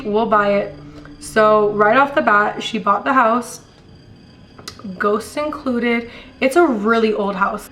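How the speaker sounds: ordinary talk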